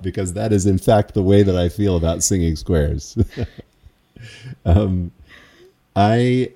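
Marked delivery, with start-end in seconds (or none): none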